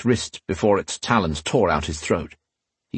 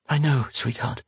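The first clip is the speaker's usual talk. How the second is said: soft, slightly raised, soothing voice; tender comforting; 4 semitones higher, 4 dB quieter than usual. A narrator's reading. Catherine comforting crying Sonia in a hug, tender. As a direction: soothe a crying child gently.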